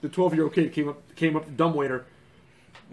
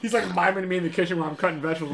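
ordinary talk

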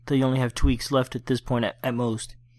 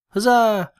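higher, louder triumphant huzzah; celebratory cheer; 7 semitones higher, 5 dB louder than usual. Real, not acted. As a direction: cheer the good news out loud. Celebrates new domain name.

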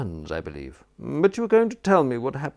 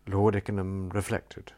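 usual speaking voice

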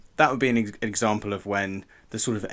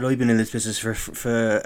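put-on accidental Cornish accent; 4 dB louder than usual. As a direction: attempt a famous singer, land halfway into Cornish. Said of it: "I'm doing a Cornish accent".

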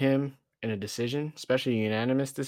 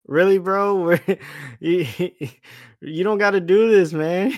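laughing disbelief; 6 semitones higher, 9 dB louder than usual. Retold or imitated. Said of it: "even Moreno was like".

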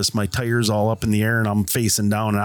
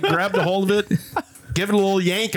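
ordinary delivery

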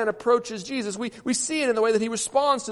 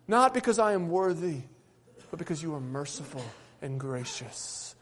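humble prayer; 4 semitones lower than usual. Acted. Preacher prays for mercy before the congregation, a humble prayer. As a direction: pray it humbly, letting it sink away.

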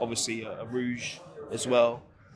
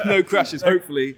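"No crashes" repeated louder through a laugh; 6 semitones higher, 10 dB louder than usual. Real, not acted. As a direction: say "No crashes, hopefully" again, louder and laughing for someone who misheard.

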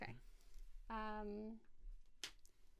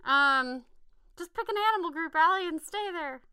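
frantic panic; 6 semitones higher, 8 dB louder than usual. Real, not acted. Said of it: "I'm freaking out".

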